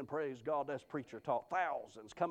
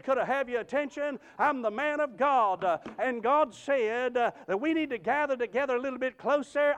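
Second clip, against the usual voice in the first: high, loud, proclaiming pulpit voice; full-force preaching; 5 semitones higher, 6 dB louder than usual. Real, not acted. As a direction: address the graveside crowd with ringing, stretched-out fervor. Preaches to graveside crowd.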